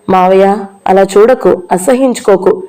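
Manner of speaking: plain reading voice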